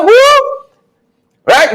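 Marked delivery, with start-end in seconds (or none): 0.0-0.5 who squeaked very high; mocking, high-pitched who; a high, incredulous who; mock squeaky who. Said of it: "Robert said".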